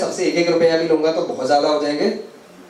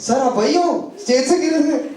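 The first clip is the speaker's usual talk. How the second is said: higher, laughing voice of an ex-student spotting him; imitating a former student; 8 semitones higher than usual. Former student meeting teacher years later, amused higher voice. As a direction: play the grinning student who remembers the fall. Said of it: "अगर काफी time बाद मिलोगे".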